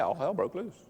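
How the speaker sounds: ordinary talk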